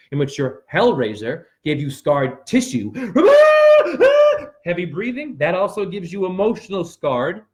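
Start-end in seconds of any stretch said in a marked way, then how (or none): 3.0-4.6 high, loud burst of laughter; shrieking laugh; wild laugh. Real, not acted. Wild laugh mid-rant.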